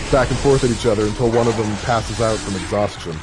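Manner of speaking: ordinary talk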